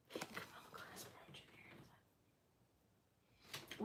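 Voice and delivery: whispered under her breath; whispering. Real, not acted. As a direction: read it to yourself in a whisper. Rereading item name to herself.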